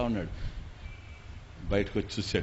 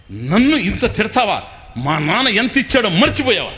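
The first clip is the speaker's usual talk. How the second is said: mock angry shout; 7 semitones higher, 8 dB louder than usual. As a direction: roar it out as if deeply insulted. Husband's staged fight continues.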